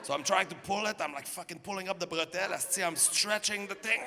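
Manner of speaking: usual speaking voice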